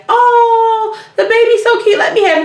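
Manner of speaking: put-on high-pitched gushing over a baby; cooing over baby. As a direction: coo like an adoring admirer. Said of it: "people that be like".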